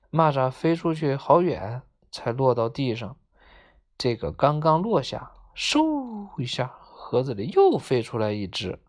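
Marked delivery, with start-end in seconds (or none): 5.5-6.4 long, high-pitched whoosh; whoosh sound. A narrator's reading. Narrator voices the next grasshopper flying out, long whoosh. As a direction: draw out a high swish. 模仿蚂蚱再次飞出.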